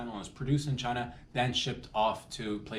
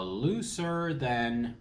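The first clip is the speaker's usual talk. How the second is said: very slow, stretched-out words; drawn-out comic setup; 2 semitones higher, much slower than usual. Real, not acted. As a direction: drag it out to tee up the punchline. Drawn-out setup of second comparison.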